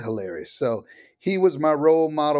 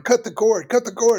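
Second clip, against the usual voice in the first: high, louder imitated insistence; urgent demand; 10 semitones higher, 7 dB louder than usual. Father imitating the mother's frantic demand to cut the cord. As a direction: echo her frantic order.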